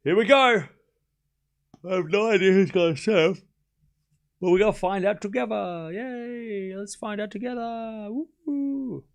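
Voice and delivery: bright high hype-up, rising to woos; excited cheer. Acted. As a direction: cheer the chat on like a party host.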